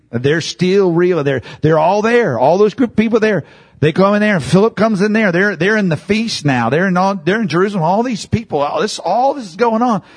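high, loud, fervent preaching; preaching at full force. Real, not acted. Preacher firing up the congregation, fervent and loud. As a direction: lift the crowd with rising fervor.

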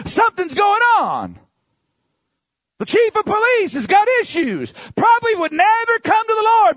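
high, loud shouted preaching; preaching at full force. Acted. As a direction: drive it home with a roar.

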